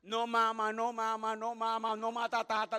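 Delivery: a loud mock stammer; the preacher hams up Moses stuttering "no mata". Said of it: "Como hablaba, escribía".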